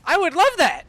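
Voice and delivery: high, loud and eager; excited. Real, not acted. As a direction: blurt it out with envy. Wishing for the same buff.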